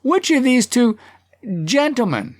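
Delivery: high, pointed oratory with a pause; tanner rephrasing. A narrator's reading. Tanner gives in to the chair, high pointed delivery. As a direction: raise the pitch and pause before the last word. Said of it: "The tanner".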